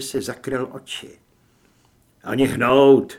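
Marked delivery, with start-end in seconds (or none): 2.2-3.1 loud commanding shout; barked order. Acted. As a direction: freeze him with a sharp yell. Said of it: "nařídil jsem mu".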